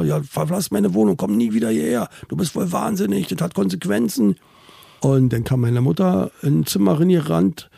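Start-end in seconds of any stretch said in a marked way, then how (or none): 0.0-4.3 angry grandfather. Retold or imitated. Grandfather orders father out.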